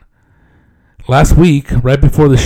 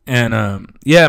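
ordinary talk, nothing marked

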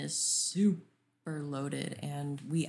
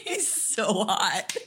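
laughing swoon; 2 semitones higher, 3 dB louder, much slower than usual. Real, not acted. Swooning over actor.

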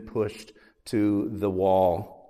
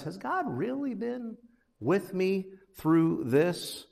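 soft, questioning inner doubt; pondering doubt; 7 semitones higher, 5 dB quieter, slower than usual. Retold or imitated. Pastor voicing the psalmist's doubt, softly questioning. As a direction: ask it quietly, as if doubting God. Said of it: "it's almost as if he's pondering".

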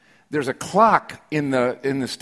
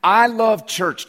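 slow, higher and louder on each word; emphatic declaration; 7 semitones higher, 5 dB louder, much slower than usual. Real, not acted. Pastor declaring his love to the congregation, slow and emphatic. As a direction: drag out I love church like a confession.

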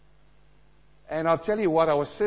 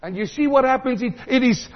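usual speaking voice

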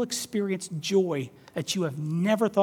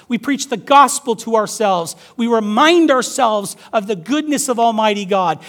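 louder, higher, rousing sermon voice; impassioned preaching; 5 semitones higher, 9 dB louder than usual. Acted. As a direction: drive it home to the congregation. Preaching at full force.

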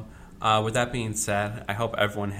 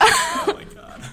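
laughing; 7 dB louder, much slower than usual. Real, not acted. Laughing exclamation.

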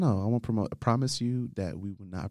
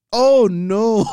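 high shriek of laughter; squealing laugh at the gossip; 14 semitones higher, 9 dB louder than usual. Real, not acted. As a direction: burst out laughing in disbelief. Friend reacts to awkward confession.

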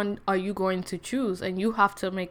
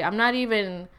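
ordinary talk

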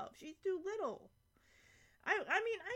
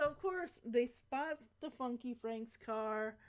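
usual speaking voice

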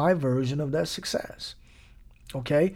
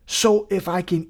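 ordinary talk, nothing marked

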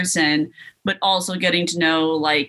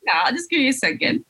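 playful quip; 7 semitones higher, faster than usual. Acted. Joking reply, fast and high.

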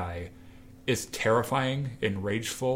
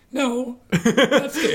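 very high, loud squeak of denial; mock-scared, squeal it through a laugh; nervous denial; 9 dB louder, much slower than usual. Kylo denying his fear to Snoke, nervous high squeal. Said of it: "he's, like, sweating bullets".

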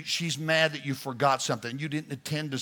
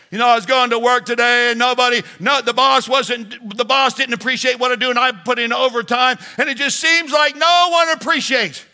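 high, loud, rushed wife's rant; complaining wife; 10 semitones higher, 15 dB louder than usual. Acted. Pastor voicing a wife venting to her husband, high and loud. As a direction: pour out the wife's grievances, high and fast.

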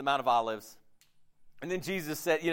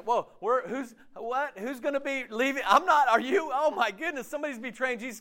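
higher, hammed-up flustered disciples; mock panic; 8 semitones higher than usual. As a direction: play the disciples losing it a little. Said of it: "when you're panicking".

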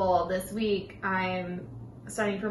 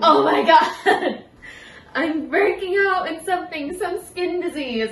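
louder, higher hammed-up alarm with a laugh; mock panic; 8 semitones higher, 8 dB louder than usual. Telling mom about a rash, hammed-up panic. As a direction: overplay the dread, laughing. Retold spider bite panic.

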